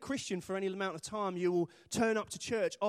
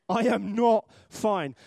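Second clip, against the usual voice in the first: NOT hit loud and drawn out; slow, loud, word by word, weight on "not"; emphatic; 4 semitones higher, 8 dB louder, much slower than usual. Shown as a demonstration. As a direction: punch "not" hard and spread the words out. Spelling out what fine really means.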